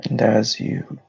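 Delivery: unhurried meditation voice with long pauses; guided meditation. Real, not acted. Host leading listeners through breathing and grounding, meditative delivery. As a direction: guide them into calm, phrase by phrase.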